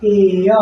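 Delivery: teacher models एय; vowel demo. Shown as a demonstration. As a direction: repeat एय for students. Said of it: "क्या पढ़ते हैं".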